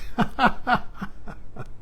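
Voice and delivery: breathy amused laugh; real gentle laughter; soft laugh. Real, not acted. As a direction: laugh it out quietly. Laughter at the end.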